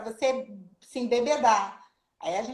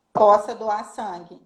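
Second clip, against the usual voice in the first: louder and slower on "possa doar sangue"; emphatic; 8 dB louder, slower than usual. Real, not acted. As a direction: land "possa doar sangue" slowly and firmly. Ênfase no direito de doar. (ênfase lenta e forte em "possa doar sangue")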